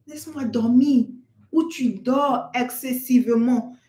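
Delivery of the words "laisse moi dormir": exasperated mother. Acted. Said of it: "Tu entends".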